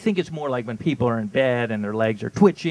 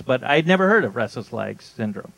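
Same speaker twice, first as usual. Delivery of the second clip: ordinary delivery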